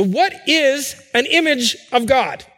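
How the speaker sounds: What drawn out slow and weighty, falling at the end; a preacher's slow, deliberate opening question. Acted. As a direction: ask "So what is an image of God?" slowly, letting each word land. Preacher poses the key question.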